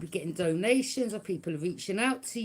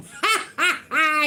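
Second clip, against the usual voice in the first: mocking laugh; 7 semitones higher, 7 dB louder, slower than usual. Real, not acted. Laughing at the haters.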